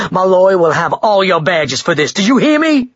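snarling shout. A narrator's reading. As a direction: bark the threat, climbing into a shout. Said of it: "Blaine was snarling".

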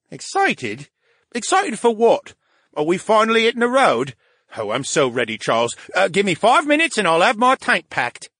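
high, loud, complaining sidekick voice; a needy brain in a tank griping at his friend; whiny brain voice. Acted. AB eager to leave.

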